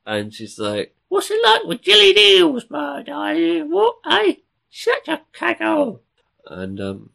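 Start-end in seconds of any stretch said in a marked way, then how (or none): 1.1-6.0 screechy falsetto Cockney woman; very high, loud Cockney caricature; Queen Elizabeth. Acted. Cockney queen answering Raleigh's discovery, hammed-up falsetto. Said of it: "she was a Cockney".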